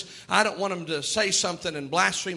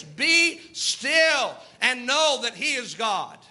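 slow refrain; slower than usual. Acted.

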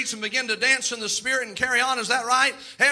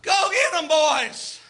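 fan shout; 5 semitones higher, much slower than usual. Retold or imitated. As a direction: yell like a fan at the big play. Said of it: "See, I'm already drunk".

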